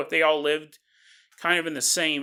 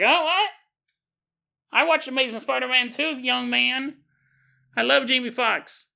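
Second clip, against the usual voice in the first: put-on high, prim old grandma; old lady voice; 8 semitones higher than usual. Grandma explaining she watched Spider-Man, high old-lady voice. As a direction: chirp it back like a proud old lady, higher. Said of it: "She's like".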